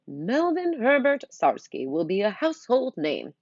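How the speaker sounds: slow loud proud announcement; grand proclamation. Acted. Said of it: "His voice gets louder".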